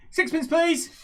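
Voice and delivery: high, chirpy little vendor; halfling merchant. Acted. Halfling merchant asking for sixpence, high sales voice. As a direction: ask for payment in a halfling squeak. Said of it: "He says".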